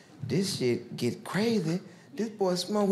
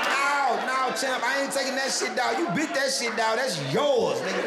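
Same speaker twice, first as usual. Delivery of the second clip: higher, louder heated yelling; mock shouting; 6 semitones higher, 3 dB louder than usual. Teen yelling at Champ about the bitten rock, heated and loud. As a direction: shout him down, pitched up.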